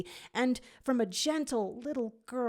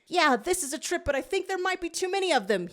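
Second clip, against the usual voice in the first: much higher, fast and louder; yelling over battle; 10 semitones higher, 4 dB louder, faster than usual. A narrator's reading. Fetch yells to the squad mid-battle, high and fast. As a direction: shout it across the fight. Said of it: "yells Fetch".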